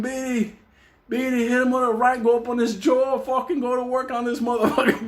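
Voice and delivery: high, urgent corner-man coaching; mock yell of fight instructions, not full volume; retold screaming. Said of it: "I'm screaming".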